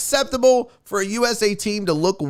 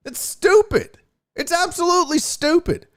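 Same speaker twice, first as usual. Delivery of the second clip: 1 exasperated outburst; 10 semitones higher than usual. Real, not acted. Ranting about Russia ban.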